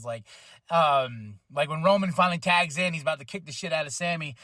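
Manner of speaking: ordinary delivery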